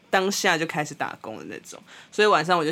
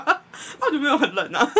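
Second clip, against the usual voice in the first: higher, laughing through it; 8 semitones higher than usual. Real, not acted. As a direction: repeat it while cracking up. Repeats while laughing. (笑着重复)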